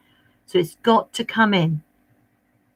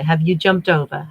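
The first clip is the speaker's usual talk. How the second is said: ordinary talk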